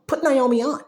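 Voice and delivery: loud, high, insistent demand. Acted. Friends demanding Naomi be booked.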